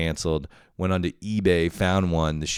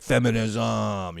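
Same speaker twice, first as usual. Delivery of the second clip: imitated girlfriend; 5 semitones higher, much slower than usual. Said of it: "she's like".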